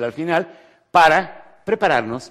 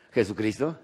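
ordinary talk, nothing marked

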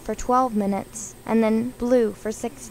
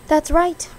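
higher, louder confirmation; cried out; 5 semitones higher, 6 dB louder than usual. A narrator's reading. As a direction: exclaim it brightly. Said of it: "cried Ginger".

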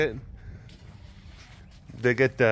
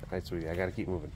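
much softer, quick goodbye to the cat; 6 semitones lower, 12 dB quieter, much faster than usual. Real, not acted. Telling the tiger goodbye, soft and quick. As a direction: gently excuse yourself from the tiger.

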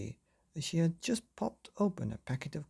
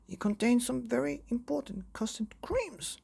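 high, fretful father's voice; fussy father; 9 semitones higher than usual. Acted. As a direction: fuss over the biscuits first. Father keeps talking from car.

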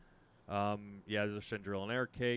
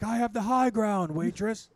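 slow, high, loud dramatic movie line; Obi-Wan quote; 11 semitones higher, 10 dB louder, slower than usual. Pretend. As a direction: proclaim it like a Jedi. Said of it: "you'll be like".

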